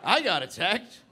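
high, loud, halting tirade; indignant shouting with dramatic pauses; outraged explanation. Acted. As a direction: deliver it in pieces, building to a yell. Indignant explanation to new roommate.

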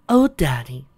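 high, loud daughter voice; affectionate daughter. Acted. Daughter kissing her father good morning, affectionate. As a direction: exclaim it fondly, high and loud.

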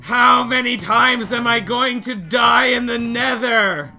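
high, loud, drawn-out lament; exasperated shouting. Real, not acted. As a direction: wail the question in frustration. Raging after another death.

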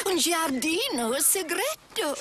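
fluent Italian pronunciation of Un giardino segreto; Italian spoken with easy native polish; Italian phrase. Real, not acted. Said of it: "how lovely to be able to speak Italian like that".

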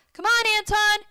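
high, loud call held up in pitch; yelling back. A narrator's reading. Jake yelling back to Antoine while pedaling away, urgent shout. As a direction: call urgently to someone lagging behind. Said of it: "Jake yelled back at me".

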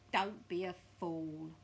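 loud, slow put-down; Mr Twit scornful. Acted. Mr Twit dismissing his wife's idea, loud slow scorn. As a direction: scold her with drawn-out scorn.

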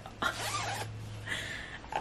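high breathless squeal of a laugh; can't hold in the delight. Real, not acted. Gushing to viewers over the pillowcase, squealing laugh.

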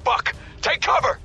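ordinary talk

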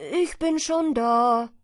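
loud, slow smug call. A narrator's reading. Hedgehog calls to the arriving hare, slow and smug. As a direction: stress ich, drawn out. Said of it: "rief ihm der Herr Igel entgegen".

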